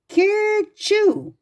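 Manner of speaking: a sudden high sneeze sound; rabbit's sneeze. A narrator's reading. Reading to a child, the rabbit sneezes high and sharp. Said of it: "Peter sneezed".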